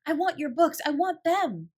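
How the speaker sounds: high and loud, almost crying out; passionate want. A narrator's reading. Sarah craving the books, passionate and loud. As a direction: hunger for the books, fervent. Her eyes grew big, chest heaved.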